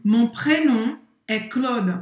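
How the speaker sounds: "Mon prénom est Claude" said slowly and clearly; slow model sentence. Shown as a demonstration. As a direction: say it slowly for learners to copy. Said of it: "você poderia falar também". (frase-modelo lenta para repetir)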